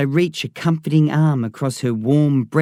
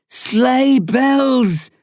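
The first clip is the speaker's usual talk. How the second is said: louder, much higher shout of the words; shouted repeat; 11 semitones higher, 3 dB louder than usual. A narrator's reading. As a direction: bark "Sleigh bells" out insistently. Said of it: "the man repeats".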